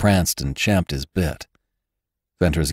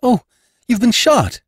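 aghast, high-pitched exclamation; frightened cry; 3 dB louder than usual. Acted. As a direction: gasp it out high in alarm. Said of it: "cried Jane in affright".

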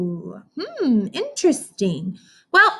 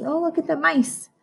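normal talking voice

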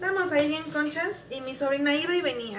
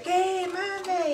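child calling back to mom, lightly acted; imitated calling back; 7 semitones higher than usual. Hijo contesta al llamado de mamá. (respuesta cantadita y aguda, sin gritar)

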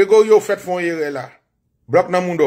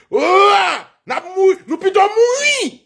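high, drawn-out acted shout; acted rallying yell, words stretched; defiant battle cry; 10 semitones higher, slower than usual. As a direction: cry out like fighters choosing death.

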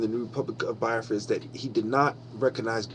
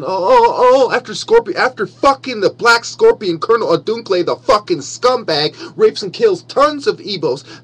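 loud, furious outburst, high-pitched; angry; 7 semitones higher, 8 dB louder than usual. Real, not acted. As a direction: spit it out in rage. Furious rant about massacre.

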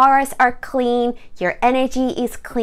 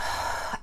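audible breathy sigh out; exhale through the mouth; 11 dB quieter than usual. Shown as a demonstration. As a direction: demonstrate a soft mouth exhale. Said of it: "exhale through our mouth".

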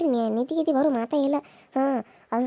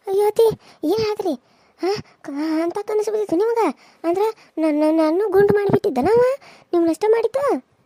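put-on higher, louder worried young wife voice; a young daughter-in-law fussing anxiously over her mother-in-law; 7 semitones higher, 4 dB louder than usual. Daughter-in-law voiced by same actor. (ಆತಂಕದ ಸೊಸೆಯ ಎತ್ತರದ ಧ್ವನಿ)

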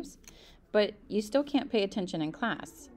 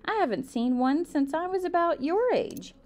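1 surprised gasp; 7 semitones higher than usual. Acted. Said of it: "She gasped".

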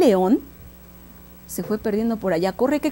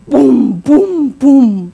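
three loud, separate booms; imitated booming bangs; 3 semitones higher, 9 dB louder, much slower than usual. A narrator's reading. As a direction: boom out each burst. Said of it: "el miedo se le salió".

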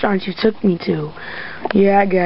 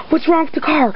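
normal talking voice

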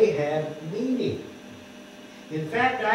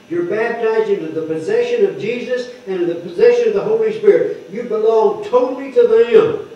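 preaching; 4 semitones higher, 6 dB louder than usual. Acted. Preaching on baptism into possession.

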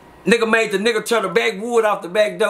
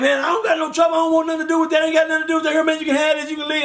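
higher, pleading woman handing it over; scared lady; 8 semitones higher, faster than usual. Retold or imitated. Scared lady hands over money.

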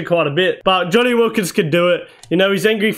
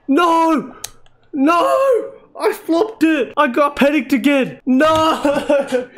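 high, wailing cries of no; anguished disbelief; 8 semitones higher, much slower than usual. Real, not acted. Gamer who flopped the big chance, anguished wailing. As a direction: wail in frustration at your own blunder.

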